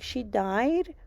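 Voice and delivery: hint of a startled child asking; little boy's shock. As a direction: lightly voice a surprised small boy. Mom voicing son learning death.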